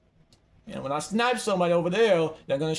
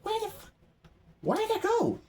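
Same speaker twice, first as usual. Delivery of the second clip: squeaky, much higher voice; high-pitched bewilderment; 5 dB quieter than usual. Real, not acted. Player lost track of it, high-pitched bewilderment. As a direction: shoot way up high, baffled.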